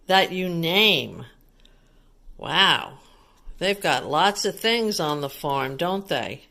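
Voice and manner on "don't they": ordinary talk, nothing marked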